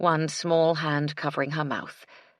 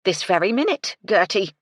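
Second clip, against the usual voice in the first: stern command; 4 semitones higher, 7 dB louder than usual. A narrator's reading. Housekeeper insisting.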